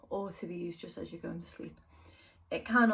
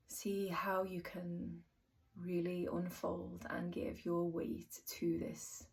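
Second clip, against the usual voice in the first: soft, unhurried meditation voice with long pauses; guided meditation; 6 dB quieter than usual. Acted. Teacher talking listeners through a yoga nidra body scan, soft guided meditation. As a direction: guide them gently into rest, softly, phrase by phrase.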